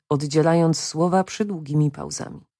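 ordinary delivery, nothing marked

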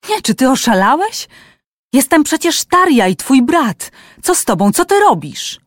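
loud, high, panicked pleading with his brother; frightened pleading. A narrator's reading. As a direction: beg in rising panic, cracking up high at the end. Terrified man pleads with brother. (przerażone, głośne błaganie, wysoko)

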